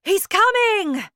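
high, loud, thrilled shout; excited cry. A narrator's reading. Wonka announcing the boy's arrival, crying out. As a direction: call out the big moment. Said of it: "cried Mister Wonka".

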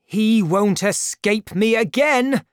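high, loud determined shout. A narrator's reading. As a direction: shout it as you grab your sword. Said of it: "shouted D'Artagnan".

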